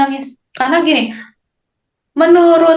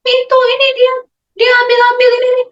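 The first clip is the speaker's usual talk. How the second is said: put-on high, fast child's whine; crying child; 11 semitones higher, faster than usual. Child tattling on sibling to the parent, high fast whine. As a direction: whine like a little kid telling on a sibling.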